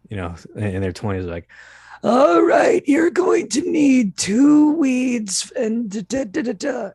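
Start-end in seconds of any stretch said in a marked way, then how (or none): 2.0-6.9 much higher, louder, slow cartoon elder; a young actor overdoing an old person's voice, shrill and slow; hammed-up cartoon old voice. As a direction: ham up a cartoonish old herbalist voice. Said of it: "young people try and do old voices, they tend to ham it up".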